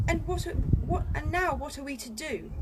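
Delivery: fretful, demanding what next; Edmund. Acted. Edmund lost in the wood, high fretful question.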